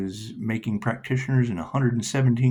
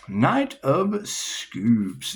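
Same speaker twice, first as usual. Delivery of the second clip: slowly intoned card name; much slower than usual. Acted.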